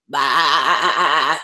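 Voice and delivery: low bleating "Bah" like a sheep. Pretend. Showing the kids her sheep pen, a low sheep bleat. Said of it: "I like the sheep pen".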